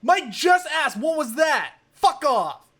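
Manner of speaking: loud high shout upward; shouting up scaffolding. Real, not acted. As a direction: bellow it up at the neighbor. Shouting up at neighbor.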